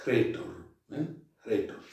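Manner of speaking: "Retor" with a breathy H before the R, as if hretor; rough breathing on retor. Shown as a demonstration. Teacher models "Retor" with rough breathing. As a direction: push air out before "Retor". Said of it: "una H aspirada".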